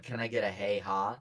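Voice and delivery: low mocking repeat of hey ha; imitating a line. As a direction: parrot it back, deadpan and low. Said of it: "your voice said".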